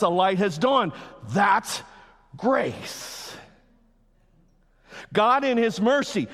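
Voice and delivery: loud, high, driving sermon voice; fiery pulpit climax; full-force preaching. Acted. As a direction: preach it hard to the crowd.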